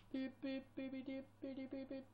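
steady monotone beeps; imitated beeping. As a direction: make little electronic beep noises. Imitates beeping during the game.

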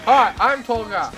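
ordinary delivery

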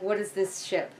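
ordinary talk

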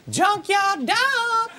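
high, slow exclamation; drawn-out howled line. Shown as a demonstration. Actor showing how his line goes, high and drawn out. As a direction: draw it out high like a howl. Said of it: "All right, you just go".

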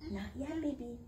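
ordinary delivery, nothing marked

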